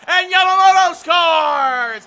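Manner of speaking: shouted, high-pitched goal call with stretched words; excited goal call. Real, not acted. Announcer calls Yamamoto's goal for the Rangers, shouting excitedly. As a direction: belt out the goal, drawing out the scorer's name.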